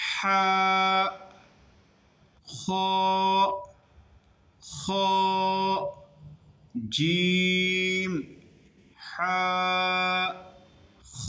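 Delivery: জিম, হা, খ drawn out, each carefully articulated; hard J in জিম, squeezed হা, rasped খ, each held; letter recitation. Shown as a demonstration. Teacher reading three letters with students, জিম, হা, খ slowly articulated. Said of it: "তিনটা হরফগুলো পড়ি".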